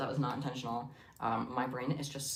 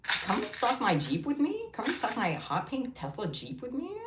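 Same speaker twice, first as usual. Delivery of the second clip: higher, sing-song influencer pitch, slowed; 11 semitones higher than usual. Retold or imitated. Mocking influencers to her viewers, high sing-song voice. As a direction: coo it like a lifestyle influencer. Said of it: "that influencer voice".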